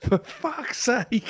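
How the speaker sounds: slow, high-pitched wail of despair; exasperated wail. Acted. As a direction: stretch it out high, full of frustration. Echoing the fan's exasperation.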